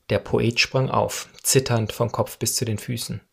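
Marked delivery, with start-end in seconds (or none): none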